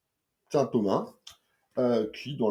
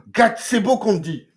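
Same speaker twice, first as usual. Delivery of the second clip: gasp, then higher and louder, slow; awed gasp; 10 semitones higher, 9 dB louder than usual. Real, not acted. Reviewer shows viewers a stunning page, awed gasp. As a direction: marvel at the page out loud.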